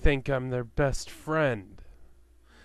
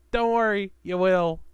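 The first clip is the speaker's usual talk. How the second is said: cocky taunt; 6 semitones higher, 5 dB louder than usual. Retold or imitated. Taunting the angry family.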